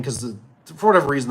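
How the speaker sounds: ordinary delivery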